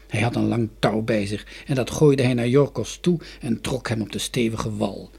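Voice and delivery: usual speaking voice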